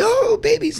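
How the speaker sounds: put-on high, loud baby-talk coo; cooing at babies. To people who love their babies, a high loud coo. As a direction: gush over a baby in a sing-song.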